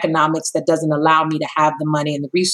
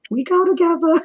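pleading; 12 semitones higher than usual. Retold or imitated. Playful courting back and forth.

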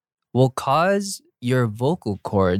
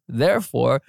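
ordinary delivery

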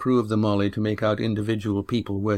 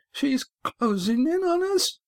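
very high, panicky sailor's cry; voice rising in alarm. A narrator's reading. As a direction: let the alarm push the voice way up. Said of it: "his voice rising".

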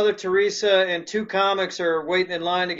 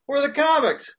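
punchline voice; 7 semitones higher than usual. Acted. Punchline of joke.

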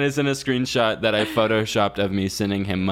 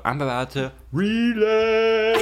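high, slow singsong tease; playful high drawn-out tease; teasing singsong; 9 semitones higher, slower than usual. Pretend. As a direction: draw it out high like a joke threat. Jokes about relapsing in Mexico.

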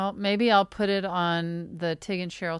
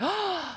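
visitor's admiring gasp, imitated; high, sharp mock gasp; retold gasp; 10 semitones higher, 5 dB quieter than usual. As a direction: gasp like an impressed guest. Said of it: "they're like".

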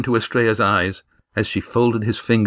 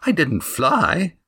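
put-on slow, tart retort in a high girl's voice; with asperity; 8 semitones higher, slower than usual. A narrator's reading. Eva snapping back at Damon, slow tart retort. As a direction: bite back dryly and slowly. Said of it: "said Eva with asperity".

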